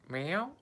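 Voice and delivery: high squeaky imitation meow to the cat. Calling back to the cat Katana, high squeaky meow. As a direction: mew up high like a kitten.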